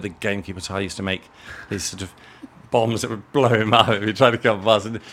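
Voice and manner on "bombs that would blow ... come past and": louder, laughing through the words; amused laugh while telling it. Real, not acted. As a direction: chuckle as the memory amuses you. Laughing recalling a boyhood war.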